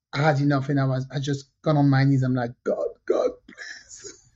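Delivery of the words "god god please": whispered, desperate prayer re-enacted; whispered plea. Woman on her knees praying to God, whispered plea. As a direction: beg under your breath, almost no voice.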